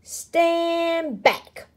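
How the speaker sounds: big booming command, pitched high; a bold booming call. Acted. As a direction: boom it out over the crowd. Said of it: "boomed the voice".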